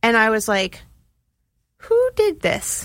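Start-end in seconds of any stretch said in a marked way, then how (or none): 1.8-2.8 high, slow stunned question; amazed. Retold or imitated. Cook finding the green room fully set up, amazed. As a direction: draw it out in high-pitched disbelief.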